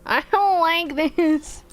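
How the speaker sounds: high, slow drawn-out whine; mock-distressed high whine. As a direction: stretch it out in a high whimper. Repeating the same complaint, whining.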